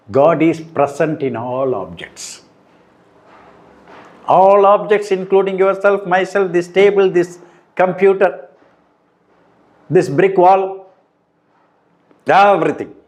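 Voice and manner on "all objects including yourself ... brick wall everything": each item pitched high and pressed; raised, insistent teaching voice; emphatic listing. Shown as a demonstration. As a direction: hammer home every object in the list. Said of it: "So if you want to be emphatic".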